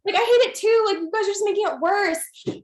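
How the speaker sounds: very high and loud, close to a wail; fed up, begging them to stop; a near-wailing plea to online haters; exasperated protest. Retold or imitated. Acted reply to hair haters.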